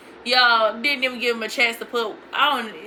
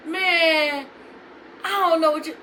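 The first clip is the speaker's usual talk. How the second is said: higher-pitched mock innocence; imitating evasive boyfriend; 4 semitones higher than usual. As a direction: mimic him playing clueless.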